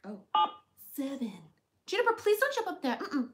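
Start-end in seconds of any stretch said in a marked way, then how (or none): 1.9-3.3 pet-scolding voice. Real, not acted. Scolding the squirrel, high, fast.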